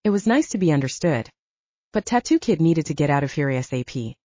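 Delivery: ordinary delivery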